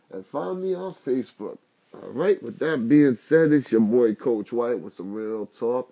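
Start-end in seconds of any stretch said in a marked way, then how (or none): 1.9-5.9 slow, loud sign-off; announcer sign-off. Acted. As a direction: boom it out like a host.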